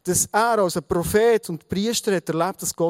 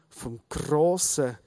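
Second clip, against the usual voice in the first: ordinary talk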